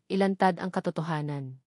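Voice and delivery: ordinary delivery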